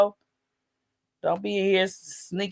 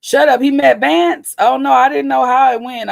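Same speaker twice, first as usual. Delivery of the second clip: shocked; 7 semitones higher, 9 dB louder than usual. Real, not acted. Streamer hearing chat gossip, loud shocked disbelief. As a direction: shut up, no way.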